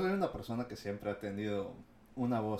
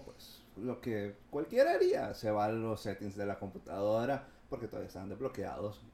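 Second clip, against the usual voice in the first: normal talking voice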